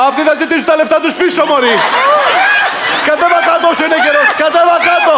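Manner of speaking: high, fast, urgent pleading; frantic scolding. Pretend. Host warns contestant to get down, frantic. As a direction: rattle out the warning in a high panic.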